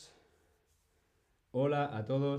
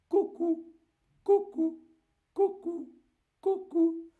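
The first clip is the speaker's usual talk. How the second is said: very high cuckoo "cucú"; cuckoo call; 6 dB louder than usual. Shown as a demonstration. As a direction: chirp "cucú" like a clock bird. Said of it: "el reloj de cuco". (imitando el cucú muy agudo)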